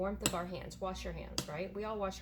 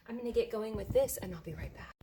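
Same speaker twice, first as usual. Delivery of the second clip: ordinary delivery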